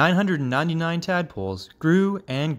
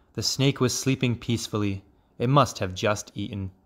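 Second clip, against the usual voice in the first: plain reading voice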